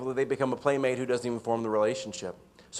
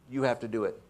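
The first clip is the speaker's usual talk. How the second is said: somewhat defeated; 2 semitones lower, 4 dB quieter than usual. Retold or imitated. Bagheera hands the job to Baloo, somewhat defeated. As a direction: hand it over with a touch of defeat. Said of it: "He says it in a somewhat defeated tone".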